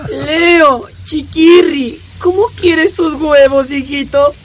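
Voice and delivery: high, sweet mom voice; doting mother voice. Acted.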